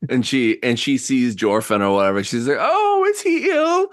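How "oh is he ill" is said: put-on very high-pitched elderly lady; worried old woman. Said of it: "I'm very old".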